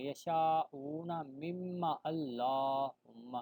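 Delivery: یشاؤون run into مما slowly; slow chant joining یشاؤون مما; joined recitation. Shown as a demonstration. Teacher showing joining words, slow chant. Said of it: "پیچھے والا لفظ ملا لیں گے".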